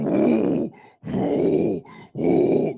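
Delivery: mock engine dying. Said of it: "Now you sound like this".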